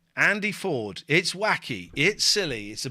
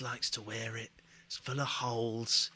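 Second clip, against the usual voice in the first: hushed whisper; 8 semitones lower, 10 dB quieter than usual. Real, not acted. Sick, mentions covid.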